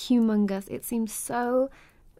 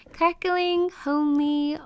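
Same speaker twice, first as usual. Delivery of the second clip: higher and louder, delighted; showing off the fireplace to a guest, delighted; excited gushing; 5 semitones higher, 4 dB louder, slower than usual. Acted. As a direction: gush over the fire.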